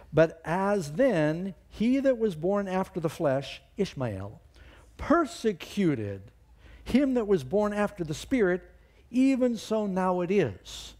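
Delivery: slow, loud, high proclamation; scripture read at full preaching force. Acted. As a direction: proclaim Paul's words slowly and heavily. Preacher reading Paul with force.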